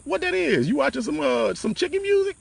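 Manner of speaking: high-pitched imitation of a baffled mother; puzzled mama. Mama asking about noise on the phone, high puzzled imitation.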